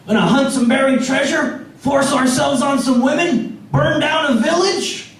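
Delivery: mock eager pirate recruit; eager new pirate. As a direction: pitch it up, a bright eager greenhorn asking about the plan. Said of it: "he gets all excited to be a pirate".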